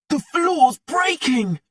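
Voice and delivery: high, slow alarmed shout; a boy yelling a warning to his friends. A narrator's reading. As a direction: cry out as the floor gives way. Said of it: "shouted D J".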